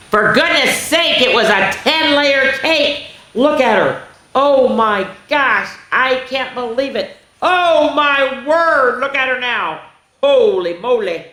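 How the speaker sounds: high, slow, drawn-out astonishment; astonished. A narrator's reading. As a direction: gush over it in wide-eyed awe. Reader gasps at the cake.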